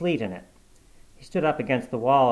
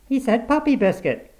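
a woman's voice, much higher, mocking; amused woman passer-by; 12 semitones higher, 5 dB louder than usual. A narrator's reading. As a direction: mimic a passing woman laughing at him.

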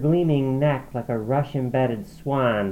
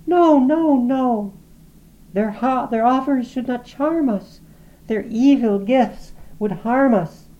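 much higher, louder fearful insistence; frightened refusal; 13 semitones higher, 6 dB louder than usual. A narrator's reading. Lizzie urging Laura to resist, high frightened insistence. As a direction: cry out against the goblins in fear. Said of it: "said Lizzie".

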